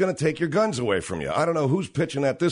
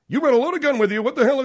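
high, loud mock panic; host mocking Matthews in a shrill panic; mocking panicked anchor; 12 semitones higher, 6 dB louder than usual. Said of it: "what he said".

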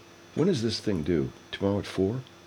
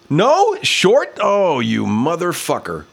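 high, loud cry sliding into a slower curse; real outraged disbelief; 9 semitones higher, 4 dB louder, much slower than usual. Real, not acted. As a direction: shoot up in disbelief, then curse it. Kick falls short, outraged.

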